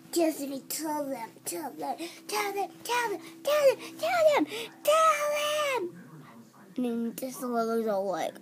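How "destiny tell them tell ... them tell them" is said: building to a high, loud plea; pleading. Retold or imitated. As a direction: beg louder and higher each time. Begging owner to tell.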